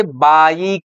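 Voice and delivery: "बाइक" with the आई drawn out slowly; long I demo. Shown as a demonstration. Teacher shows students the I sound, "बाइक" drawn out. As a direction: stretch the I vowel in "बाइक". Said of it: "यहाँ आई को हम आई बोलेंगे".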